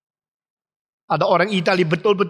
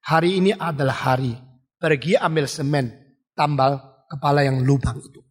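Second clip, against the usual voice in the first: lightly voiced calm, low father; 5 semitones lower than usual. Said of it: "tenang mengatakan". (ayah tenang menasihati anak, suara rendah)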